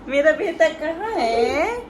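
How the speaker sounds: playful seeking. Real, not acted. Mother searching for her hidden son, loud singsong.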